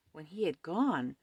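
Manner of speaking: usual speaking voice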